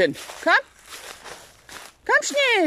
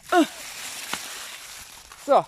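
usual speaking voice

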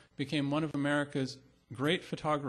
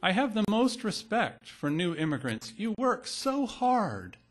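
raised pitch, slowing to an emphatic close; quoted poem voice; 6 semitones higher, 4 dB louder than usual. Acted. Reading a poem's voice addressing new immigrants, raised and emphatic. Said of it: "a voice says".

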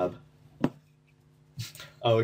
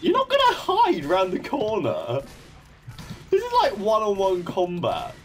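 high, loud taunting; excited taunt; 10 semitones higher, 3 dB louder than usual. Real, not acted. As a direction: call it out, fired up. Taunting enemy in game.

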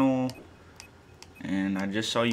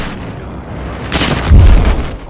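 long, loud, breathy yell; drawn-out shout; 13 dB louder, much slower than usual. Real, not acted. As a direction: stretch out a shocked yell. Loud drawn-out exclamation in firefight.

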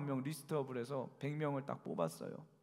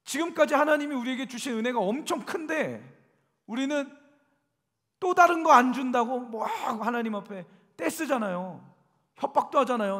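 higher, louder preaching to the congregation; full-force preaching; 9 semitones higher, 11 dB louder than usual. Real, not acted. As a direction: drive the point home from the pulpit.

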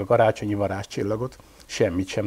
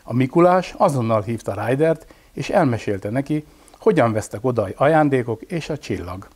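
ordinary delivery, nothing marked

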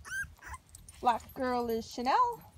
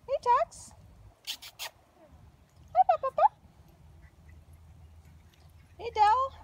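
higher, louder sing-song call; puppy calling voice; 11 semitones higher, 7 dB louder than usual. Real, not acted. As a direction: call the pups over sweetly. Calling puppies in pet voice.

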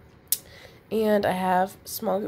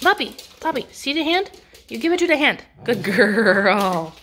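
coaxing the puppy; 3 semitones higher, 7 dB louder than usual. Real, not acted. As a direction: coax the dog to drop the ball. Coaxing puppy to drop ball.

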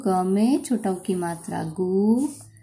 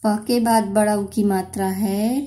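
normal talking voice

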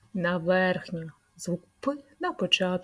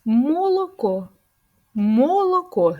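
"Молоко" said slowly, higher and louder, each syllable clear; slow model word; 6 semitones higher, 6 dB louder, slower than usual. Shown as a demonstration. Speech teacher to little kids, slow loud model word. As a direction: draw "Молоко" out slowly and firmly. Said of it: "Є тут звук П?".

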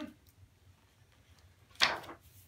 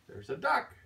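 usual speaking voice

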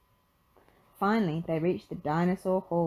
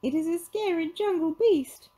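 very high cartoon fright; scared, high-pitched sidekick; Shaggy yelping; 12 semitones higher, 3 dB louder than usual. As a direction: yelp it high, in alarm. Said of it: "Shaggy yelped".

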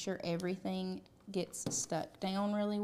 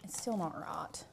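whisper; 3 semitones lower, 8 dB quieter than usual. Real, not acted. Muttering to herself while crafting.

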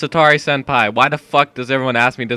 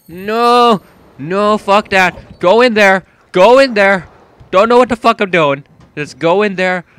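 high, loud frantic shouting; scared player urging himself forward; panicked yelling; 6 semitones higher, 8 dB louder than usual. Real, not acted. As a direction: panic and push yourself on. Panicked in horror game.

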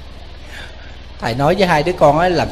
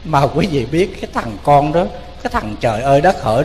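normal talking voice

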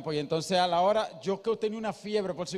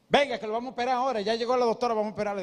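hurried doctor; 4 semitones higher, much faster than usual. Retold or imitated. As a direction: rush in announcing the surgery. Doctor rushes in to operate.

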